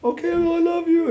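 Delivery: high, loud, loving sign-off; imitated gushing mother. Mother ends call with son, imitated gushing love.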